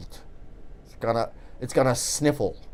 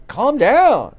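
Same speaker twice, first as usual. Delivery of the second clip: a louder, punchy "Calm down"; sharp calm down; 9 semitones higher, 7 dB louder than usual. Retold or imitated. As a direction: snap "Calm down" out like a stranger cutting you off.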